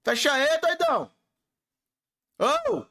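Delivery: high-pitched exclamation; calling out. Real, not acted. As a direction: call out high, ending on a squeal. Exasperated calling out while closing something. (chamando em tom agudo)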